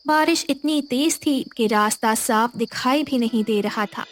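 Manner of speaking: usual speaking voice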